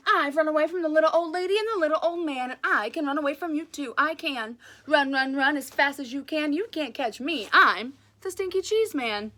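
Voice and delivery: cheese man voice. Acted.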